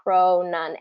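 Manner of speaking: measured two-syllable drill; pronunciation drill, one syllable at a time; slow mouth training. Shown as a demonstration. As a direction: repeat the syllables carefully and separately. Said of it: "train your mouth slowly".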